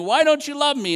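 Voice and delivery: high, loud quarrelling cry; retold screaming. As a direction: mock-scream it at a spouse. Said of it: "they're both screaming at each other".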